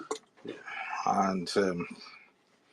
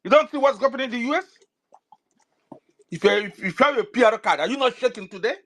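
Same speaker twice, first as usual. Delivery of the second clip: raised, urgent, fast scolding harangue; heated rant; 11 semitones higher than usual. Real, not acted. A speaker on a live space scolding diaspora supporters, heated and high. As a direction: scold the listeners at full pitch, pressing hard.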